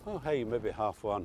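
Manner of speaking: usual speaking voice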